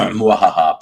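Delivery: hammed-up villain cackle; a quick put-on evil laugh; mock evil laugh. Host joking with a mock villain cackle.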